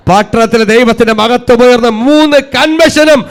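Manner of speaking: rousing sermon climax, rising steadily; preaching, building to a shout. Real, not acted. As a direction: climb in pitch to a loud, drawn-out cry.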